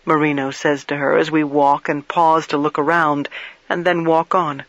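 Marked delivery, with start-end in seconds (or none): none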